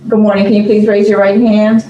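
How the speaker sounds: ordinary delivery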